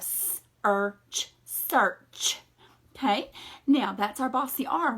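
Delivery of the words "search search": "search" stretched out slowly, then said whole; slow blending. Shown as a demonstration. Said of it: "in this word we would have".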